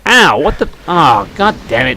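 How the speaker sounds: normal talking voice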